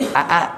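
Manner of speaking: "a a" as two separate, high-pitched calls; two distinct glottal onsets on "a a"; tahqiq. Shown as a demonstration. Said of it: "itu tahqiq namanya".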